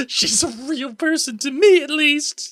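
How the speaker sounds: very high, put-on pleading voice; hammed-up high-pitched plea; mock-plaintive squeal. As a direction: squeak it out in playful protest. Playful mock plea for the character.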